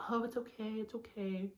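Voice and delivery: high, soft cooing reassurance; mock consoling. People comforting the sad one at a party, mock consoling. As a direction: put on a small, soothing voice, gently mocking.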